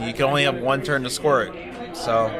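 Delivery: ordinary delivery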